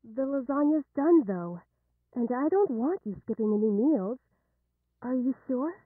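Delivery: loud, insistent motherly call; calling through a door. Acted. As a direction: project your concern loudly. Mother calls through bedroom door.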